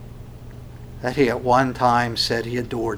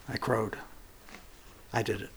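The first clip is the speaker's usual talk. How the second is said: low, very soft admission; hushed remorse; 4 semitones lower, 17 dB quieter than usual. Acted. Peter remembers his boasting, hushed remorse. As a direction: confess it barely aloud.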